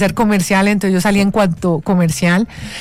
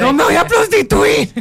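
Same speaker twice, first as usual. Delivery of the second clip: hammed-up reassuring daughter; mock protest to mom; 8 semitones higher than usual. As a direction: squeak it out like a defensive daughter. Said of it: "Y yo le decía a mi mamá".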